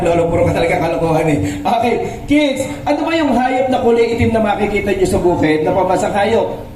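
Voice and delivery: ordinary talk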